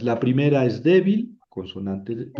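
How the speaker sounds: normal talking voice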